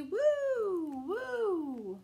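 high sung woo woo like a whistle; playful whistle for children; train whistle woo. Acted. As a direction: call it out like a train horn.

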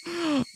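sudden high gasp of realization; sharp gasp. Real, not acted. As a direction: gasp as if struck by an idea.

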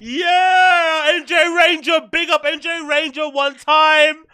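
high, full-volume hype shouting; excited shout-out. Real, not acted. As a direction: yell a big, drawn-out thanks to a donor. Celebrating a viewer's donation.

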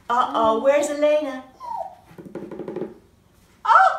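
normal talking voice

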